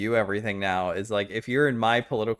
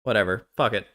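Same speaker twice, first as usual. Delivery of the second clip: normal talking voice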